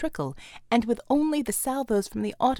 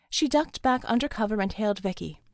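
ordinary delivery, nothing marked

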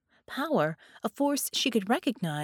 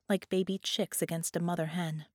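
ordinary delivery

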